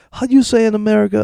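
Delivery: high mock voice of a fake foreigner; mocking falsetto. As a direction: mimic her fake helplessness. Mocking her fake accent.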